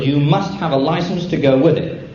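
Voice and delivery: ordinary talk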